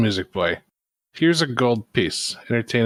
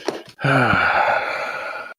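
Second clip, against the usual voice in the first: long, audible exhale; heavy breath out; long sigh; 3 semitones higher than usual. Pretend. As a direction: let out a drawn-out sigh. Exasperated before relaxing.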